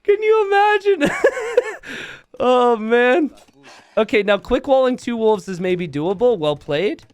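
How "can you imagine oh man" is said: howling laughter at the blunder; laughing. Real, not acted. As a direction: laugh until it squeaks. Caster laughing hard.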